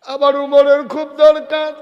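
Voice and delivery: high, loud preacher's cry; full-force plea. Real, not acted. Preacher pleads for an Umar, at full force. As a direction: cry out the need with all your force.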